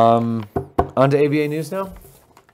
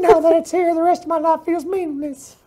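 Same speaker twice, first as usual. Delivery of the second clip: cartoon creepy old guy, pitched way up; creepy old dude; 13 semitones higher than usual. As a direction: croon it like a creepy old admirer. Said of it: "that creepy old dude from Family Guy".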